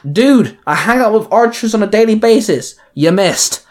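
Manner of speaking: high, cocky cheer. Acted. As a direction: gloat like a cocky kid. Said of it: "Robin cheered".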